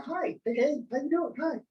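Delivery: high, eager little "Hi, hey"; eager shy kid greeting. Retold or imitated. Young Dave copying Tom's greeting, high and eager. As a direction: chirp greetings like an eager small boy. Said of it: "the little guy went around".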